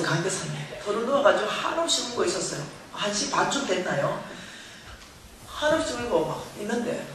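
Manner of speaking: ordinary talk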